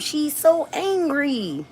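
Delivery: high, slow mocking whine; mocking critics. Mocking the online critics in a high slow drawl. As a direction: parody the haters saying it. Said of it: "promote that across the globe".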